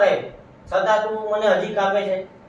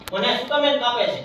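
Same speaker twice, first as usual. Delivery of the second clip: ordinary delivery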